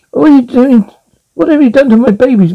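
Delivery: high, shocked cry of alarm; horrified. Acted. Wife finds her pets stuffed, horrified and high. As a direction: react to the stuffed pets in horror.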